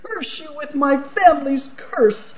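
put-on high, furious cursing of the girl; angry curse. Archduke curses the little girl, high and furious. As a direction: spit the curse out high and hard.

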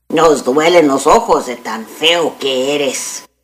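ordinary delivery, nothing marked

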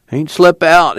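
a tired father groaning awake; sleepy moan. A narrator's reading. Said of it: "he moaned".

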